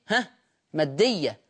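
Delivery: ordinary talk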